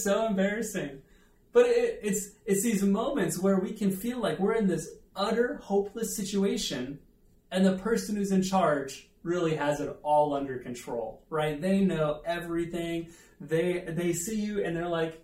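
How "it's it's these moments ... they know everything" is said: ordinary talk, nothing marked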